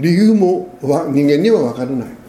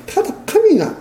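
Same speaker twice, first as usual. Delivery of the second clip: emphatic. Real, not acted. Preacher names God emphatically.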